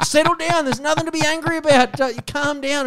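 high mock-soothing caller voice; scam caller calming. Scam caller trying to calm the screaming man, high mock voice. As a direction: squeak out the caller's "settle down". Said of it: "And she was like".

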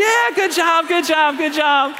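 high, loud-ish repeated cheering; retold celebration. Family congratulating a lady who passed her test, acted jubilant cheering. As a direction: re-enact jubilant congratulations, pitch way up.

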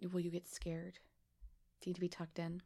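put-on soft teasing whisper, like tucking in a child; mock bedtime whisper. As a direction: hush it as if at a child's bedside.

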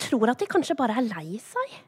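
ordinary talk, nothing marked

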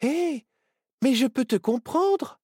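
very high, excited owl character; high-pitched burst of amazement; surprised exclamation. A narrator's reading. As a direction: exclaim at a sudden discovery. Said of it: "Myrtille Chouette s'exclama".

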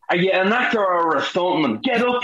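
high mock barking order; mock drill shout. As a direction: imitate a drill instructor yelling. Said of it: "basically they were like".

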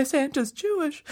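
put-on soft, high, amazed child; first quiet repeat. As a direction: say it small and high, stunned. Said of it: "started repeating it louder and louder".

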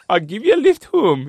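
hammed-up imitation of his accent; Tommy Miller's accent. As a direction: put on his accent for a laugh, on request. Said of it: "Can you do it in his, in his accent as well?".

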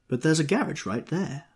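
high, quick objection; objecting. A narrator's reading. Jordan objecting to Tom about the garage. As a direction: push back on him. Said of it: "objected Jordan".

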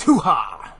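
loud "hoo-ha" exclamation; explosive playful whoop; mock whoop. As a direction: punch out a loud comic hoo-ha. Mock exclamation ending exasperated rant.

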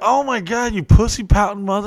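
high, fast mocking jab; mock disgust. Mocking his pouting friend, high and fast. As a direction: sneer it at your buddy.

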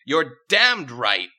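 slow, loud, high royal affirmation; regal decree. Acted. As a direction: decree it with swagger. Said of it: "Princess Colin decreed".

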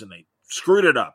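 retold anger. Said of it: "he was just so mad".

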